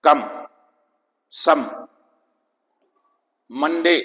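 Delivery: कम, सम, मंडे with O as a stressed "uh"; stressed schwa. Shown as a demonstration. Teacher showing the seventh O sound, stressed schwa. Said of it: "इसमें stress अलग है".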